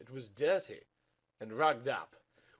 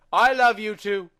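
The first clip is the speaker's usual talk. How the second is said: loud declaration; 10 semitones higher, 15 dB louder than usual. Acted. Loud emotional reply.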